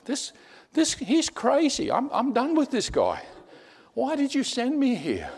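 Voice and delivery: very high-pitched, exasperated complaint; fed-up exasperation. Retold or imitated. Missionary complaining to God about this crazy man, exasperated. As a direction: plead upward in high, incredulous frustration.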